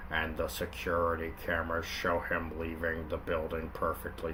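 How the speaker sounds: low, soft, slow cop narration; lightly voiced hard-boiled cop; Linkara cop voice. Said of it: "I'll just do Linkara".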